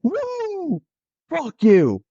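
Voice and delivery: high whooping yell; yelling taunt. Retold or imitated. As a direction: whoop it high then bark the curse. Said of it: "Lance Lynn just goes".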